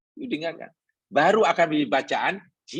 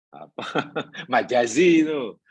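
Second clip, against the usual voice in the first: laughing; 3 semitones lower than usual. Real, not acted. Chuckles while searching for term.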